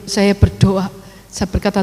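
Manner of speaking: normal talking voice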